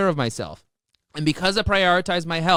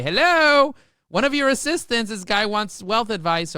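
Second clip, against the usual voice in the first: chirpy assistant alert; 6 semitones higher, 4 dB louder than usual. Retold or imitated. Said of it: "flag it for you and say".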